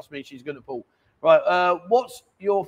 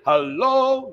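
sound check hello; 5 semitones higher, 5 dB louder than usual. Shown as a demonstration.

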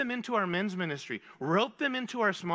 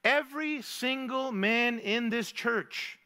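ordinary talk, nothing marked

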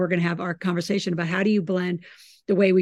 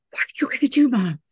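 mock whisper; 5 semitones higher, 3 dB louder, faster than usual. Daughter voiced in retold story.

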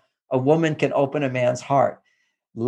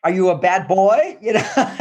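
laughing; 4 semitones higher than usual. Real, not acted. Laughs through mock scolding.

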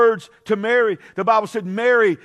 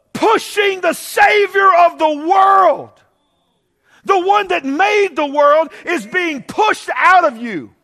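fervent preaching; 11 semitones higher, 8 dB louder, much slower than usual. Real, not acted. Preaching climax.

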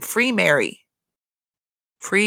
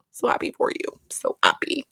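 sarcastic hush; 7 semitones higher, 3 dB quieter than usual. Acted. Fake congratulations to Lisa.

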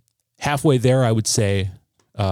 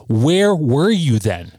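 drawn-out, higher-pitched demand on each 'where'; indignant questioning; 7 semitones higher, 3 dB louder, slower than usual. Retold or imitated. Son confronting his mother over her absence, indignant questioning. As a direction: challenge them, voice leaping up in exasperation. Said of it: "saying, like, out loud".

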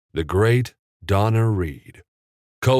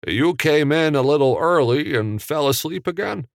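calling loudly; 9 semitones higher, 3 dB louder than usual. A narrator's reading. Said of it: "Jimmy called loudly".

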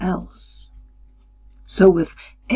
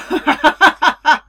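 booming laugh; 8 semitones higher, 3 dB louder than usual. A narrator's reading.

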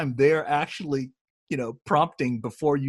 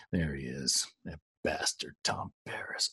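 hushed, low, much softer whisper; 9 semitones lower, 11 dB quieter than usual. Retold or imitated. Castmate whispering a snide aside on set, hushed whisper. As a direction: whisper it low so the passing actor can't hear. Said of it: "in a very low voice to me".

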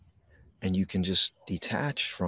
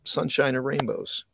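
sing-song fake brightness, pitch leaping high; sarcastic sunshine voice; a parody of instant, forced positivity; mock cheery acceptance; 8 semitones higher than usual. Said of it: "flip a switch and go".

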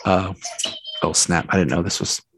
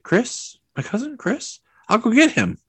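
surprised; 12 semitones higher than usual. Retold or imitated. Jim quoted, surprised reply.

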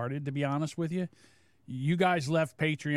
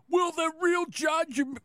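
high-pitched mocking impersonation; high, put-on imitation; Eric; 12 semitones higher than usual. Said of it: "let me get my Eric voice on".

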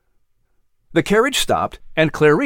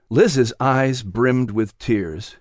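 usual speaking voice

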